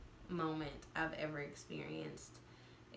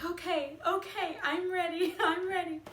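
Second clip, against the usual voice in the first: high, louder, half-laughing resolve; psyched up; 11 semitones higher, 5 dB louder than usual. Retold or imitated. Mother in labor finally agreeing to push, high and giddy. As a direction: pump yourself up for the last push. Giving in to the pushing.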